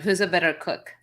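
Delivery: ordinary talk, nothing marked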